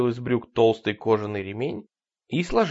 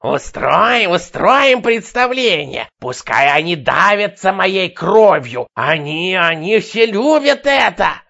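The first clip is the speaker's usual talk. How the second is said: hysterical shouting; 7 semitones higher, 10 dB louder, slower than usual. Acted. Madwoman raving at crowd.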